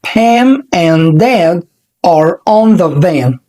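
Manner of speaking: Pam and Dad are on the van said very slowly, word by word; slow teaching read. Shown as a demonstration. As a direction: spell it out slowly for learners. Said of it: "بننطق بالراحة جدًا".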